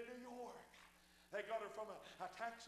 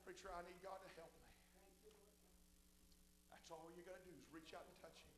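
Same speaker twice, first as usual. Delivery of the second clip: much softer and lower invitation; hushed altar call; 5 semitones lower, 14 dB quieter, faster than usual. Acted. Preacher inviting the bowed congregation, hushed. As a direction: draw them in gently.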